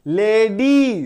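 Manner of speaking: Lady drawn out as ले, डी; syllable by syllable. Shown as a demonstration. Teacher showing two syllables, lady said in stretched parts. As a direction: stretch both halves of lady evenly. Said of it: "दो syllable".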